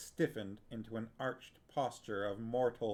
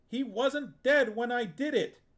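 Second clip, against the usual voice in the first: much higher, much louder furious shout; a boy screaming at his aunt; 13 semitones higher, 9 dB louder than usual. Acted. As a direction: yell it at her in rage. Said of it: "Geryon shouted at her".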